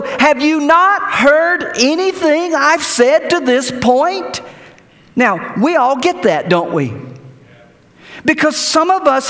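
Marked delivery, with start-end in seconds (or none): 0.0-4.4 exasperated teacher voice. Retold or imitated. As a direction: spell out their deafness in disbelief. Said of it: "it's as though".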